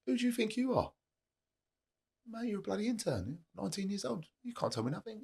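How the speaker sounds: high, mocking dismissive voice. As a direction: scoff at the young intern. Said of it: "I'd be like".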